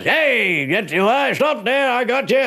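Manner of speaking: high-pitched mock yell; startled homeowner yelling. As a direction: call out like you've caught someone red-handed. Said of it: "I heard the bloke yell out".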